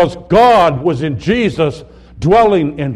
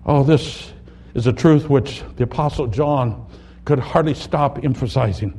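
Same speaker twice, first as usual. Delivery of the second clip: ordinary delivery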